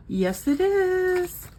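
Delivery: gasp, then a high "it is"; delighted gasp. Real, not acted. Finding her savings reached the goal, gasping with delight. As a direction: gasp as if surprised by good news. Discovers she hit target.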